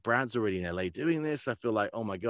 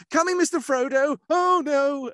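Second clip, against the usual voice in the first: much higher, louder bumbling hobbit voice; bumbling Samwise; 8 dB louder than usual. Acted. Voicing Samwise for the card game, high bumbling. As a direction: play a flustered, bumbling Sam. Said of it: "a bit more bumbling".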